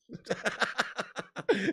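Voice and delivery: high laugh bubbling over; real giggling fit. Real, not acted. Streamer still looking at the sent item, laughing. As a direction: keep cracking up helplessly.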